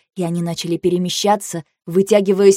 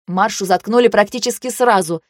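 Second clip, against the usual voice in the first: ordinary delivery, nothing marked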